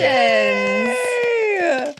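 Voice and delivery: high, long-held tender cheer; delighted cheer. Real, not acted. Host cheering the couple's long marriage, delighted. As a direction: draw it out high, delighted for them.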